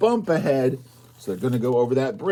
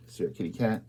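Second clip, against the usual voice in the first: plain reading voice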